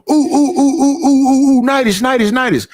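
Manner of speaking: lightly voiced eager friend calling his name; mock excited calling.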